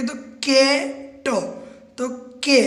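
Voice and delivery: क and ट sounded as isolated consonant sounds; sounding out. Shown as a demonstration. Teacher sounding out cat letter by letter for the class. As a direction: sound out the word piece by piece. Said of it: "हमको खाली sound करके देखना है।".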